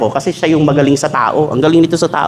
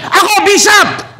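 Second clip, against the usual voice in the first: high, slow, drawn-out proud answer; eager congregant; much slower than usual. Acted. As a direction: play the keen churchgoer volunteering. Congregant answers bishop, acted dialogue.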